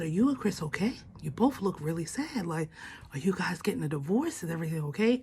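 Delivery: low, soft, careful questions; imitating a worried dad's quiet check-in; concerned father. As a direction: ask gently, voice dropped. Father gently asking after sad daughter.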